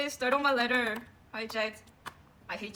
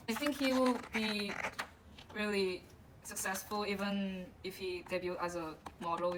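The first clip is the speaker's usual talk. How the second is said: usual speaking voice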